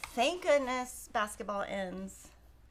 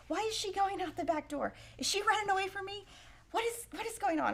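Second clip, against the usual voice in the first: a lightly voiced puzzled husband, pitched higher; mock husband; 8 semitones higher than usual. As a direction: imitate a confused husband, raised pitch. Husband Kevin quoted, upset.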